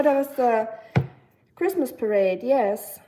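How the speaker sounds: normal talking voice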